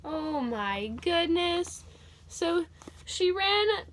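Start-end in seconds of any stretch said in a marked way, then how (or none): none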